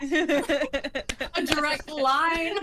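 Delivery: giggling through the words; squeaky laughing aside. Real, not acted. Laughing about drooling image.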